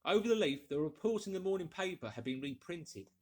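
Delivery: plain reading voice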